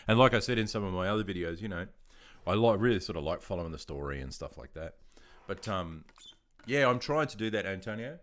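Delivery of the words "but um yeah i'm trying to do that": ordinary talk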